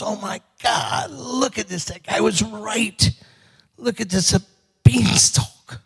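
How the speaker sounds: high, hushed wonder; awed amazement. Acted. Jack amazed at the beanstalk.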